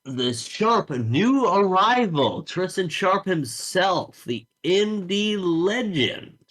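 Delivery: high, drawn-out ring announcer call; Rick. Acted. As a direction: stretch each big name like a hyped match announcer. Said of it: "Rick says".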